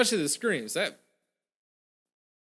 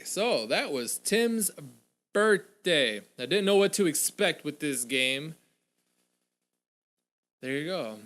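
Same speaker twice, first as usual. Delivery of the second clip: ordinary talk, nothing marked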